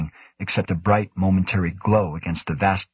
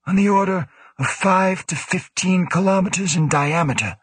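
flatly; 11 semitones higher than usual. A narrator's reading. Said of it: "Woe said flatly".

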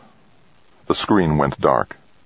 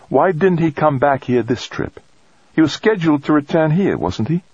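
much higher woman's voice, questioning; woman colleague; 11 semitones higher than usual. Acted. Woman asking colleague about the missing man, questioning. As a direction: press him with questions in a high, lifted voice.

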